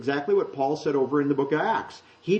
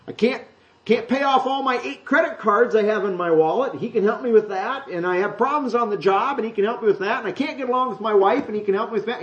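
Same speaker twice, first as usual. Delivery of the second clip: whiny believer; 7 semitones higher than usual. Retold or imitated. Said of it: "'cause they got problems".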